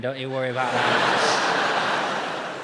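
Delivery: slow, loud mock reassurance; fake reassuring. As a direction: soothe over the noise, slowly.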